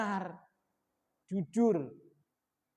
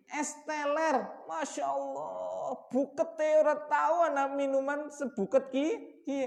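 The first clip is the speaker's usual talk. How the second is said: delighted exclamation; 6 semitones higher than usual. Retold or imitated. Imita el deleite al romper el ayuno.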